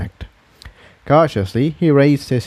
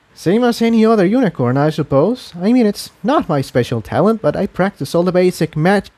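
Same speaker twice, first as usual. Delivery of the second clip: taken aback; 8 semitones higher than usual. Acted. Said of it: "Incendia looked taken aback".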